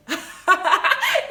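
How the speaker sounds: a quick genuine laugh; burst of laughter. Real, not acted. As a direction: laugh out at the joke. Laughs at own joke.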